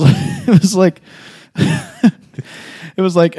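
high, laughing and drawn out. Real, not acted. Telling a friend the embarrassing story, laughing high. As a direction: laugh through the words.

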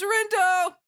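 high, loud cry of the name; desperate call. Acted. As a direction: call out to a friend in mock peril. Voices Shoka crying out under attack.

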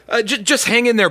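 ordinary talk

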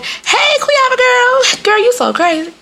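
very high, drawn-out squealed greeting; gushing schoolmate. Retold or imitated. Schoolmate spotting her out with mama, mock gushing squeal. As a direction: imitate an over-friendly girl way up high.

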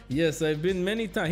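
lightly imitated Middle Eastern accent; attempted Middle Eastern accent. Driver answering his passengers' question, attempted Middle Eastern accent. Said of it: "I can't do this accent".

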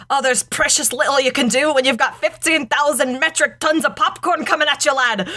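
Scotty. Acted. Host quotes cartoon Star Trek parody, mock Scottish accent. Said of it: "And there's Scotty says".

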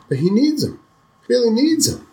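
put-on a panicked child blurting it out as he runs; frantic little boy. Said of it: "He says".

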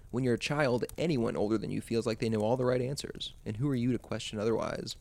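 ordinary delivery

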